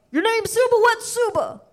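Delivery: high, puzzled caller voice; mock caller. Caller on the phone asking about her name, a high puzzled mock voice. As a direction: play a clueless caller squinting at the name. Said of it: "I'd get some guy going".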